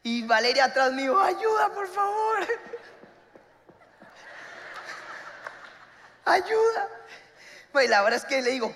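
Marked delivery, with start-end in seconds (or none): 1.2-6.9 higher, imitated frantic plea; high plea for help. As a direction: wail for help from behind. Friend calling for help behind.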